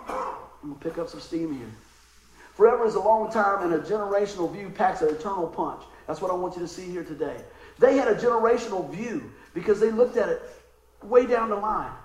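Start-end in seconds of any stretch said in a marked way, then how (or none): 2.5-11.9 full-force preaching. Acted. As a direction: preach it to the back row.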